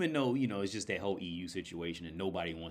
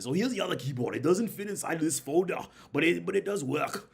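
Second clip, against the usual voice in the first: put-on high, loud Schwarzenegger-style Austrian accent; hammed-up Austrian accent, pitched well up; 10 semitones higher, 6 dB louder than usual. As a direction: imitate the Austrian seller showing his goods. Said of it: "sound just like him".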